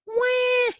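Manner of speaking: put-on very high, loud baby wail; baby ghost wail. A narrator's reading. Baby ghost startled by the noise, high wailing cry. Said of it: "yelled the baby ghost".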